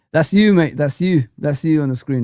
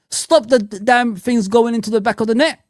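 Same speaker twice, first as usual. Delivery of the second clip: higher, raised voice at the players; exasperated rant; 7 semitones higher, 3 dB louder than usual. Real, not acted. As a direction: yell at the defence in frustration. Ranting at defenders.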